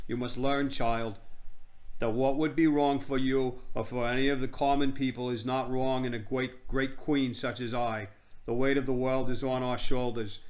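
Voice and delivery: bad Boston drawl as a grand queen; hammed-up regal Boston-style accent; haughty queen, Boston drawl. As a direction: lecture a common child in a put-on Boston drawl. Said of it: "said them in her Boston drawl".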